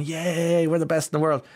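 high gleeful boast; mock cheering. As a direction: crow like a proud company. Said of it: "you had Pfizer going".

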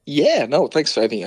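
usual speaking voice

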